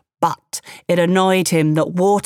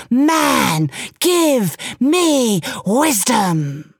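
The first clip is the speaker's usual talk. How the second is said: a mild mock growl, slow and drawn out; a hammed-up tiger, stretching the demand; 5 semitones higher, much slower than usual. Tiger springs out at the man, a mild mock growl, slow. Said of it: "he growled".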